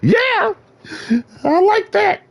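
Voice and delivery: high-pitched mocking falsetto; squeaky mock voice. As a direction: squeak it out like a cartoon. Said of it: "I said".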